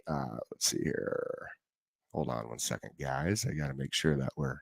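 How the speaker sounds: distracted quiet mutter, fiddling with setup; muttering aside. Real, not acted. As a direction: drop away from the mic and mumble to yourself. Host muttering while fixing setup.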